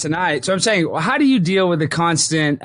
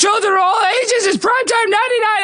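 booming, much higher circus announcer; ringmaster. Acted. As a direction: shout it out like a big-top ringmaster. Hypes guest like circus intro.

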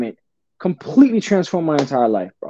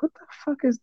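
baffled hush; 10 semitones higher, 10 dB quieter, faster than usual. Retold or imitated. Baffled by strange phone ringing.

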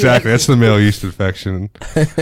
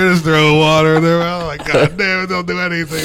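mock exasperated roommate; 7 semitones higher than usual. Mocking roommates who skip dishes, hammed-up outrage. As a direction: throw a fit as the lazy roommate would.

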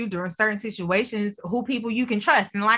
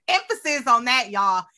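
normal talking voice

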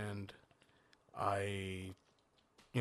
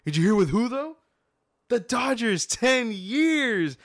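high, loud imitation of an excited coworker; screaming out; 11 semitones higher, 7 dB louder, slower than usual. Coworker hitting the speaker with more signing news, excited yelling. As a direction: yell the big news up high, hammering each piece. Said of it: "he screams out".

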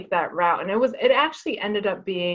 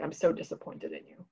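soft, quieter, a hint of a let-down teacher; disappointed teacher; 4 semitones lower, 10 dB quieter than usual. Teacher telling the fifth grader he is disappointed, soft and low.

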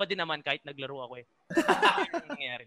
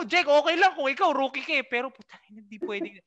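high, louder then dropping mock scold; retold angry scolding; 8 semitones higher, 4 dB louder than usual. Scolding rookie Jake about the bus, high mock anger. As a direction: lay into the rookie, then cut off. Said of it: "Galit na galit ako".

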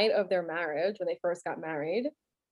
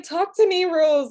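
high, loud mock plea; hammed-up desperate appeal; pleading movie husband; 8 semitones higher, 8 dB louder, slower than usual. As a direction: imitate him begging, pitched up. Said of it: "he's like".

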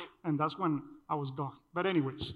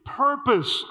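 "Purpose" punched higher and louder; stressed word; 6 semitones higher, 7 dB louder than usual. Shown as a demonstration. Preacher driving home the key word to the congregation, loud. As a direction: hit "Purpose" hard and let it fall. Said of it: "I want you guys to understand here".

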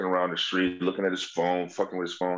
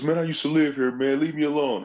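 higher, whiny mock drunk; a drunk insisting he belongs; drunk stranger; 6 semitones higher than usual. Drunk man claiming he lived there, lightly voiced whine. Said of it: "He goes".

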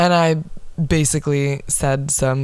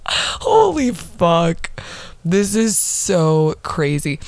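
stunned; much slower than usual. Real, not acted. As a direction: let it sink in, amazed. Stunned rereading old fight.

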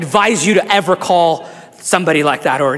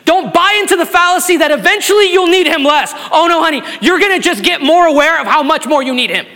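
higher, louder, shouting the climax; shouted preaching; 11 semitones higher, 5 dB louder than usual. Acted. Pastor rousing the church to admit their need, shouting. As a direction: preach it out with full fervor.

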